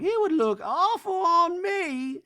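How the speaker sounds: high, slow hammed-up Reba; lofty slow mock-mom impression. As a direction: drawl it out like a haughty mother. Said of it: "And Reba's like".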